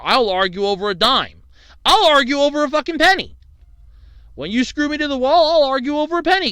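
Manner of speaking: high, loud retold outburst, building in force; indignant retort. Customer replaying his row with an overcharging cashier, indignant. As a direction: argue back heatedly, replaying the quarrel.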